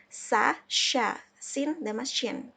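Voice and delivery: ordinary talk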